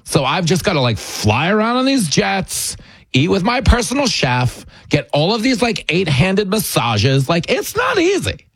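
hammed-up princess complaining about jets and massages; mock Meghan whine. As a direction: sound spoiled and put-upon, rising to a squeak at the end. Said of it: "they wanted you to believe".

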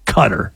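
Qatar said like "cutter"; Qatar stressed on the first syllable; insisted pronunciation. Shown as a demonstration. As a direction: drop Qatar low and quiet as CUT-er. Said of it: "that it is pronounced Qatar".

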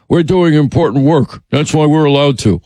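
mocking put-on politician voice; self-important excuse, mockingly imitated; John Kerry. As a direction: parody a pompous official justifying himself. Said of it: "That was John Kerry's pathetic excuse".

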